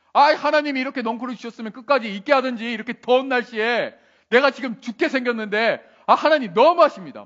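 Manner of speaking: loud, high-pitched acted grumbling at God; irritated complaining. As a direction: complain bitterly as the aggrieved prophet. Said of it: "막 짜증 불평을 냅니다". (짜증 내며 불평하는 요나 연기)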